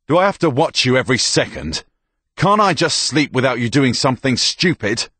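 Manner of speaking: loud, high, exasperated rebuke; exasperated telling-off; angry scolding. Acted. Soldier scolds the injured girl.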